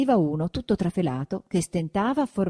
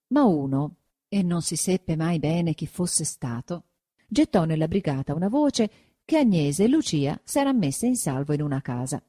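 usual speaking voice

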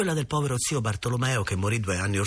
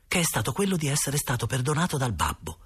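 ordinary delivery, nothing marked

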